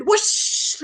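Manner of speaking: high rushing whoosh; whoosh sound. A narrator's reading. Storyteller imitating the dragon's flight, whooshing. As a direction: make the noise of something streaking past.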